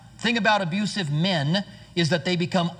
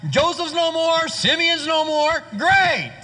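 mocking self-pity; 8 semitones higher than usual. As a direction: whine it like a sulking brother left behind. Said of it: "He's like".

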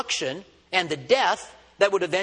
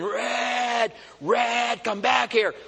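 higher-pitched mock yell, drawn-out name; retold scream; 4 semitones higher, much slower than usual. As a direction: call after a runaway dog without full volume.

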